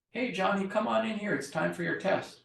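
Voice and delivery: high call to Johnny again; same call, meant to meet a zoned-out kid; high call for the test; spaced-out response. Shown as a demonstration. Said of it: "that's the spaced out, zoned out".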